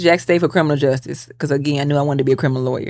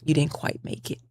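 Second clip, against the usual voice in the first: much softer, flat apology; imitating the instructor; 3 semitones lower, 13 dB quieter than usual. Nursing instructor telling the student she failed, much softer. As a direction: drop the voice and deliver the bad news softly. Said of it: "She said".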